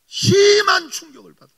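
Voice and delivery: very loud, high, tearful cry. Real, not acted. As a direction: let the shock break the voice. 충격 고백, 울먹임. (큰 충격을 고백하며 울부짖듯이)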